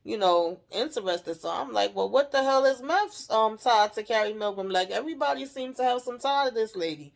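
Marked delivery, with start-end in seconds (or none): 1.9-7.1 raised pitch, exasperated disbelief; baffled exclamation. Retold or imitated. Viewer puzzles over everyone's tie to Carrie, incredulous. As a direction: pitch it up like you can't believe this. Recapper's own baffled reaction.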